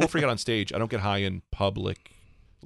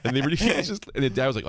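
usual speaking voice

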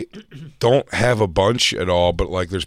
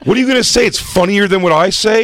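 Kurt's rant, higher and louder; comic imitation of Kurt cursing Dave out; mock furious yell; 10 semitones higher, 5 dB louder than usual. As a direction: bark it at Dave like a lunatic.

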